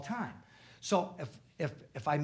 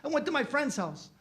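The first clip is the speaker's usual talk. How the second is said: put-on caught kid; 11 semitones higher than usual. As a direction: play the slapped kid giving in. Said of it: "He goes".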